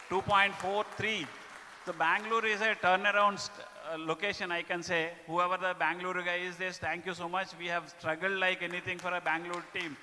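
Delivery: loud, upbeat awards announcer; award announcement. Acted. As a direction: announce the winners to the room. Announcing top states and branches.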